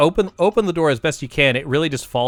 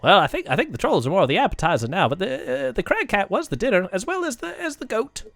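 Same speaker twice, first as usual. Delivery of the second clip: halting voice climbing higher and softer; sing-song little creature voice; chatty kobold; 5 semitones higher, 4 dB quieter than usual. Acted. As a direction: rattle it off, then falter upward. Kobold explains the meal.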